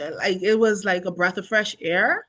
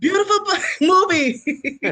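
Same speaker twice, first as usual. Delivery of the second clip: high, laughing through the words; 11 semitones higher, 5 dB louder than usual. Real, not acted. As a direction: giggle the words out. Laughing at the host's joke.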